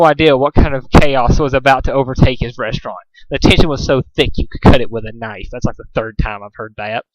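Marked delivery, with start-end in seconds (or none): none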